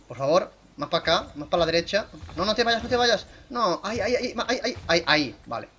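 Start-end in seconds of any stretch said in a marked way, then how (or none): 2.4-5.4 high-pitched, urgent fussing; frantic little directions, pitched up; urgent pleading. Real, not acted. Player directing a dinosaur into position, urgent.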